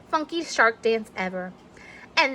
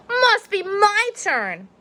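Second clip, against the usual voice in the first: sneaky; 11 semitones higher, slower than usual. Acted. Clark sneaks toy.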